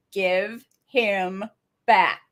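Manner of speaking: imitating a creepy kid's order; word-by-word, deliberate mock menace; creepy demand. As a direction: play at creepy insistence, pausing before the final word. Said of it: "he gets even creepier".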